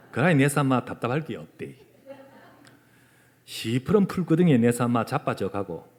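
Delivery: Psalm read in broad Gyeongsang dialect. Shown as a demonstration. Preacher reads a dialect Psalm to the congregation, Gyeongsang accent. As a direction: recite it like a native Gyeongsang speaker. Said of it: "경상도 버전은 내가 네이티브 스피커니까".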